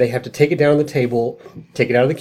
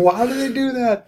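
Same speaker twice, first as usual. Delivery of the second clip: mock bafflement; 12 semitones higher than usual. Mocking baffled builders.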